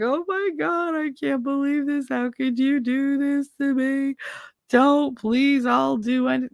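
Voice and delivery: mock heartbroken plea. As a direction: parody a clingy ex pleading. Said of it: "He knew that I was never gonna be like".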